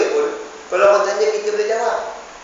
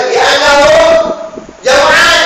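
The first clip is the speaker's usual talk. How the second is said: loud, high anguished cry; a mother's loud desperate answer; 10 semitones higher, 11 dB louder, slower than usual. Acted. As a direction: call it out as if pleading for help. Mother cries out children are hungry.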